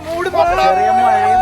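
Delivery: slow, high sing-song drawl; drawn-out sing-song. Real, not acted. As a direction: stretch it out, teasing. Drawn out repeat.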